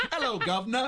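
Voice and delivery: high, hammed-up London greeting; London accent. Co-host playing along as a Londoner, mock accent. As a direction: put on a playful London geezer voice. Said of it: "[name]'s from London today".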